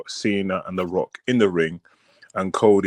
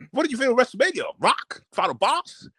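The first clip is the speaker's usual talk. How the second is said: excitable Pat; 13 semitones higher than usual. Retold or imitated. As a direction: squeal like a hyped talk-show host. Host asking Rock on show.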